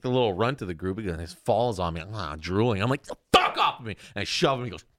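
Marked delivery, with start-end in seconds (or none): 3.0-4.0 higher-pitched startled protest; mock angry yell. Teen shoving fainting friend, retold annoyed yell. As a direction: push him away with the words.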